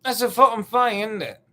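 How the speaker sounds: high, loud mock British accent. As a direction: throw it out in hammed-up British. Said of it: "if your accent".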